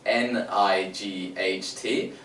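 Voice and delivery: "N I G H T" spelled slowly as letter names. Shown as a demonstration. Teacher spelling night for the kids. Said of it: "I'm saying it with the letter names".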